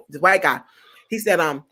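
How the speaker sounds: usual speaking voice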